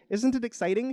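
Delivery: high-pitched thrilled egg; excited shout. Acted. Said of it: "shouts the egg again".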